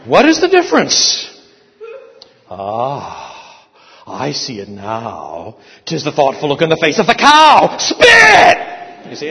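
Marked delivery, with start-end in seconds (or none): 0.1-1.3 much higher and louder, hammed-up question; teacher's mock-outraged demand. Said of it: "and he'd recite". 2.5-5.6 slow, lower, lightly voiced aha; a touch of a teacher's sly aside; mock realization. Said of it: "and he'd recite". 5.8-8.6 louder punchline, ending in a much higher shouted Spit; hammed-up drill-sergeant order; building to a shout. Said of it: "and he'd recite".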